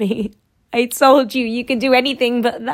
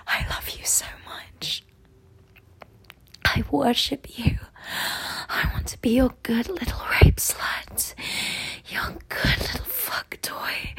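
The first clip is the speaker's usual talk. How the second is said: soft whisper breaking into a cry; whisper, then let the voice crack; hushed, tearful devotion; 9 dB quieter than usual. Acted. Whispered run, last line cracks high.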